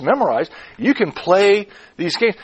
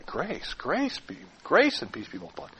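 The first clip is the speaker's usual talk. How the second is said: soft, pondering weight on "Grace"; "Grace" lifted, the rest trailing softly; stress on GRACE; 2 semitones higher, 10 dB quieter than usual. Shown as a demonstration. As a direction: dwell quietly on "Grace" each time. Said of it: "By emphasizing different words".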